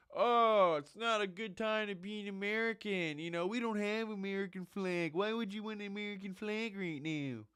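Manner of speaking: high, whining mock complaint; mocking whiny lady. Mimicking the crybaby lady, high and whiny. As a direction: whine like a crybaby. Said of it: "wanna cry about the frickin'".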